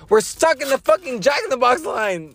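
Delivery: high mock complaint, way up; mock whine. Imagining answering Ida on the phone, high mock whine. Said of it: "we're like".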